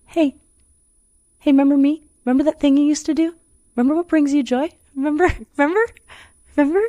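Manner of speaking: high, light coaxing little voice calling out; little inner voice. Retold or imitated. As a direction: play a tiny nagging voice tugging at your sleeve. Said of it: "this little, like".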